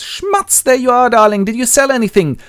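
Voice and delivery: very high, loud sing-out greeting; acted young wife. Young Linda greets Willie home from selling, eager. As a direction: greet your darling in a high girlish voice. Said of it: "young Linda comes by and goes".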